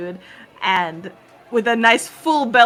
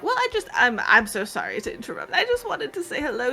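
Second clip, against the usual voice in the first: put-on high, soft fluttery old gentleman; old man; 6 semitones higher, 5 dB quieter than usual. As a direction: gush softly like a charmed old man. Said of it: "it's just like".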